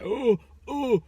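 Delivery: high, flustered mock gasp of an old man.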